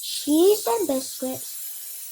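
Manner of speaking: ordinary delivery, nothing marked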